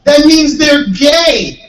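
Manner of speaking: high, slow, loud spelling-it-out; exasperated shout. Retold or imitated. Comedian shouting the explanation of his joke at the bumpkin, exasperated. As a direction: yell the obvious at someone who doesn't get it.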